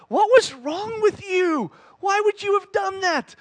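high, drawn-out bewildered questioning; hammed-up disbelief; mock incredulity. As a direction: ask it as if utterly baffled by mom. Questioning mom's past behaviour.

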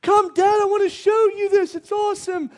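put-on high, excited little-kid voice; high-pitched, thrilled child calling out; eager child. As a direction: play a kid begging Dad to come look. Every kid wants to show Dad.

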